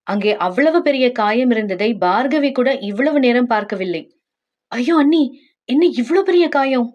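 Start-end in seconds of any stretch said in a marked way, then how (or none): 4.7-6.9 higher-pitched, alarmed cry of concern. Acted. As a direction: react with a worried, raised pitch. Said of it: "ஏன் இவ்ளோ பதட்டம்". (பதட்டமான குரலில்)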